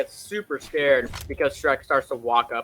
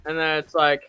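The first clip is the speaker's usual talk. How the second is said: usual speaking voice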